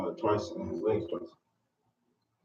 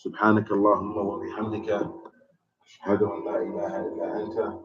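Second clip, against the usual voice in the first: quick, rote recited dua; fast recited Arabic closing; rapid closing formula; much faster than usual. Acted. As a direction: rattle off the familiar closing words.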